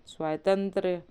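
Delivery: ordinary delivery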